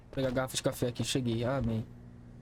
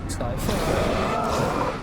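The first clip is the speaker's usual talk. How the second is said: shout; 7 dB louder, much slower than usual. Real, not acted. Fleeing enemies in the game.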